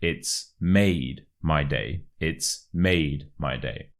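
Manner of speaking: "made" slow and prominent, "my day" lighter; slow demo with "made" set apart and stressed. Shown as a demonstration. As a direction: lean hard on "made". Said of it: "you really want to stress the word made".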